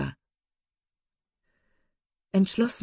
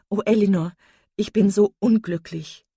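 louder, higher cry of misery; anguished exclamation; 4 semitones higher, 7 dB louder than usual. A narrator's reading. Marian pours out her misery to her sister, anguished exclamation. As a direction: cry out in heartfelt distress. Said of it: "nur ausrufen konnte".

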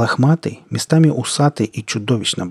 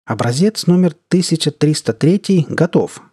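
bright, higher joyful declaring; joyful announcement; 6 semitones higher than usual. Acted. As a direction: announce it happily, pitch up. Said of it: "радостно продекларировала".

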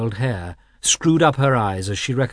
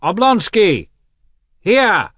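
loud, high call across the platform; a full shout, pitched well up; 11 semitones higher, 8 dB louder, slower than usual. A narrator's reading. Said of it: "and shouted".

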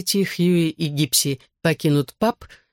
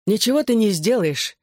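higher-pitched, mildly angry retort; angry objection; 6 semitones higher than usual. A narrator's reading. Narrator shields his friend from the opponent, mildly angry. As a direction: object firmly with a touch of anger. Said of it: "сердито возразил я Кормаку".

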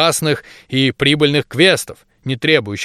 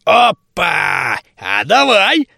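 excited; 4 semitones higher, much slower than usual. A narrator's reading. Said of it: "обрадовался Торфу".

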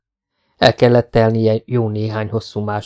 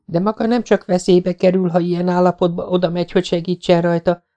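put-on insistent young woman; 8 semitones higher than usual. A narrator's reading. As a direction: refuse to let him go. Jessica argues. (határozott, erélyes női hang)

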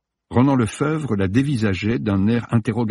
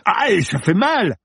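much higher, slow, pained yelp; cry of pain; 12 semitones higher, 3 dB louder, slower than usual. A narrator's reading. Student crying out as Samira twists his wrist, much higher. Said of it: "Il hurla de douleur".

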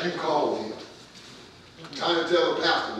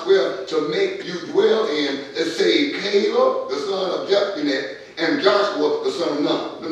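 loud, high preacher voice throughout; rousing the congregation against murmuring; full-force preaching; 4 semitones higher, 7 dB louder than usual. Acted.

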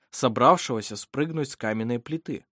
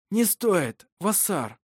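usual speaking voice

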